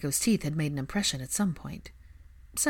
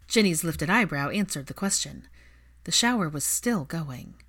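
ordinary delivery

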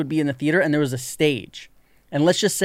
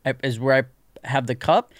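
usual speaking voice

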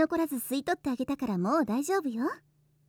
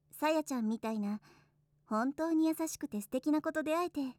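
soft and tender; 7 dB quieter than usual. Acted. Soft intimate talk.

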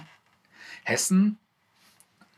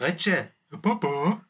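Hessian dialect; 5 semitones higher, slower than usual. Acted. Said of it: "sind die Nordhesse".

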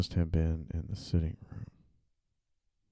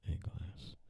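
"a glass" whispered, stress on "a"; whispered correction; 12 dB quieter than usual. Shown as a demonstration. Writer dictating, whispering the corrected article "a". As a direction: breathe the fix, leaning on "a". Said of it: "Sometimes I do that".

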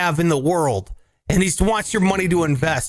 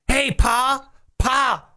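put-on higher, slow Southern country boy; 8 semitones higher, slower than usual. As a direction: ask Pa like a wide-eyed Southern farm kid. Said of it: "I have a Southern drawl".

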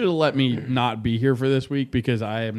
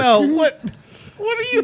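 squeaky high disbelief; baffled disbelief; 5 dB louder than usual. Real, not acted. Friend reacts to pouring beer in a toaster, baffled and high. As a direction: react in shrill confusion.

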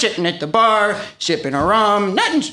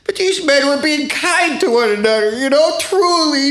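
high, overcome with emotion; tearful; 5 semitones higher than usual. Acted. Guest describes men being kind to one another, tearfully. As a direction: draw out the last words, welling up. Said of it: "I'm about to tear up".

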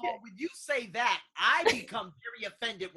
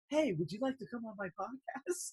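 soft, ingratiating invitation; imitating a sheepish favour-seeker; mock polite request; 9 dB quieter than usual. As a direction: ask quietly as if nothing ever happened. Acts sheepish guy asking favour.

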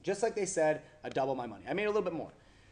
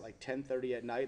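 ordinary talk, nothing marked

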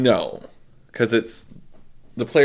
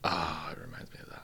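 whisper; 6 semitones lower, 15 dB quieter than usual. Real, not acted. Quiet aside.

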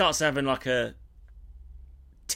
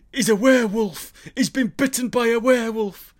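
a hint of a villager warning in the rain, louder and higher; ominous villager; 8 semitones higher, 6 dB louder than usual. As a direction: hiss out the warning like a spooked local. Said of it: "And he's going".

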